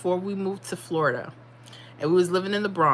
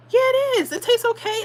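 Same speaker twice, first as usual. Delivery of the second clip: much higher, bright mock mom insisting; 4 dB louder than usual. Mom insists the pizza tastes fine, in a high mock voice. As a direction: pitch way up to play mom brushing it off. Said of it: "And she was like".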